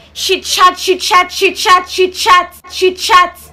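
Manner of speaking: each she chat stressed in a steady chant; repeated chat. Acted. Teacher tells mother the girl chats, rhythmic chant. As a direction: hammer she chat five times. Teacher lists pupil's chatting.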